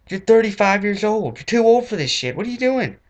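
high, loud indignant rant; mock-furious high-pitched scolding; outraged scolding. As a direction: berate the teacher in disbelief. Scolding the hip teacher.

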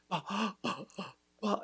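soft bah, bah on an inhale; inhaled bah. Retold or imitated. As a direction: mime the practice on an inhale. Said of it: "just going".

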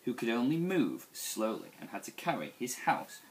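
plain reading voice

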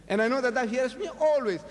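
said out loud so the people believe; loud public prayer. Shown as a demonstration. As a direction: announce it so the bystanders hear. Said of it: "talking loudly that they may know".

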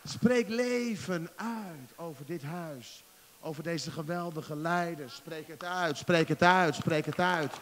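loud, slow, building sermon cry; preaching at full force. Acted. Preacher urging praise of the church, loud and slow. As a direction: proclaim it, slow and rising.

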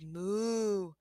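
soft distant moo; cow moo. A narrator's reading. The cow moos a soft, far-off moo to Blue.

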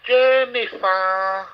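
stretched high and slow, calling her name; long drawn-out name call. Real, not acted. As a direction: sing out her name slowly. Calling out for Jenny.

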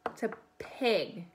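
ordinary delivery

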